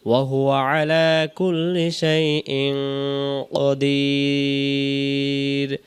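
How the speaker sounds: slow, drawn-out tajweed chant; Quran recitation. Shown as a demonstration. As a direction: recite it like a qari, very slowly. Recites again. (ধীর তেলাওয়াতের সুরে)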